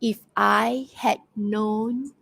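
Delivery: slow, deliberate verse reading; slow poem reading. Acted. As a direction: draw the line out slowly, pausing between phrases. Rereading poem line as hint.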